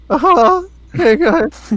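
very high, loud, cracking squeal. Retold or imitated. Imitating Cherry's voice for the boys, squeaky cracking. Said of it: "his voice crack voice is legit like".

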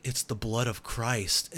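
mock solemn reverence. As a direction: hold it up like a sacred relic. Character solemnly holds vial.

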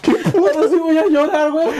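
high, strained, half laughing half crying; laughing in pain. Real, not acted. Cramping man tells cohost he'll cry, laughing in pain. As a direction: laugh through tears of pain.

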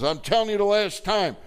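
high, loud, threatening shout; gunman's threat. Retold or imitated. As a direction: yell the last warning high and loud. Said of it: "Guy says".

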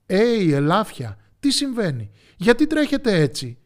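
high, loud animated call; puzzled storybook shout. A narrator's reading. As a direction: hail a running herd urgently. Pigs call to running deer.

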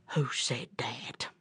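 very low, soft, slow bear voice; wary bear. Acted. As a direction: ask it slowly under the breath. Bear's wary reply in story.

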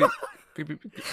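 short high machine beeps; mock analyzer beeps. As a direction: imitate a computer working, beep by beep. Pretends to run an analyzer.

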